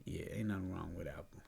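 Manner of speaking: hushed aside, unvoiced; whispering. Real, not acted. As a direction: drop to a whisper. Aside about Apple.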